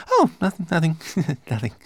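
high, fast, nervous laughing denial; hasty. A narrator's reading. Servant caught muttering by his master, hasty and high. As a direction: cover up quickly with a titter. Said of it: "replied Twigleg hastily".

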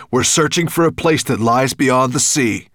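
loud, steady, resolute; firm dragon call. A narrator's reading. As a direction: declare it boldly. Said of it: "his voice sounded as it had when he rescued Ben".

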